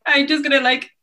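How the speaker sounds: high, loud flustered cry, hammed-up; mock panic. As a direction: squirm in embarrassment out loud. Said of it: "I sound like this little turf man".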